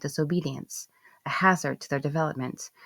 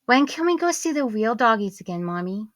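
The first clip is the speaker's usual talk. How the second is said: put-on high, louder child's plea; pleading little girl; 7 semitones higher, 5 dB louder than usual. Daughter pleading with mommy about doggies, child voice. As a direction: ask hopefully like a small child. Young daughter speaking in story.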